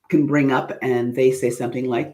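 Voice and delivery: ordinary delivery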